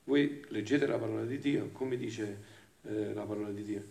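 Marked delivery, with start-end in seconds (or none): none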